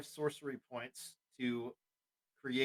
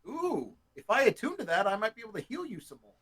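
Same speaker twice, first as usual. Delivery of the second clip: put-on old-fashioned storybook voice; a touch of an old-timey speaker; 6 semitones higher, 3 dB louder than usual. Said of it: "I don't know why I went to old-timey voice".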